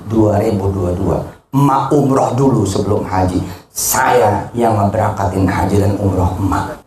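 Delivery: pelan, rendah, sungguh-sungguh; lebih yakin dan tulus. Shown as a demonstration. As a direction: ucapkan janji ke emak dengan tenang dan mantap. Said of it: "naikin lagi satu step". (anak berjanji pada emak, suara pelan dan tulus)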